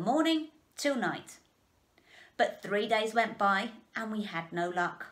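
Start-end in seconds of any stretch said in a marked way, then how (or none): none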